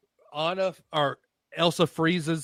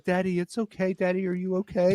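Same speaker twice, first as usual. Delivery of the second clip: daughter's voice; 6 semitones higher, 3 dB quieter than usual. Retold or imitated. Little daughter comforting crying dad.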